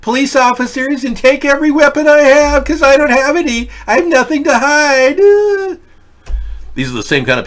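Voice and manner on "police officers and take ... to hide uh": much higher, louder sing-song mock voice; mock naive gun owner. Host parodies a Democrat welcoming the police, in a high, loud mocking voice. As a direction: play a simpering homeowner handing over his guns. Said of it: "They would love it if they could just open their doors and".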